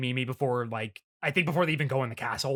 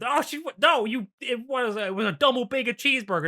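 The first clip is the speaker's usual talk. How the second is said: mock scream; 10 semitones higher than usual. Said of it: "hear them scream out".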